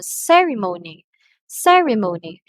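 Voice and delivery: "ceremony" twice, CE high and loud, the rest falling. Shown as a demonstration. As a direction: lift the pitch on the start of "ceremony". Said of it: "on the first syllable".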